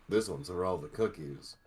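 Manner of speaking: normal talking voice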